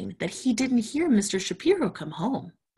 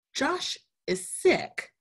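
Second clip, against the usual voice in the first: much higher, slow, gentle explaining voice; patient mother explaining; 12 semitones higher, much slower than usual. Acted. Mother Spider gently explaining to Sammy that Josh is sick. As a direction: spell it out kindly to a little one. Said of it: "explained Missus Spider".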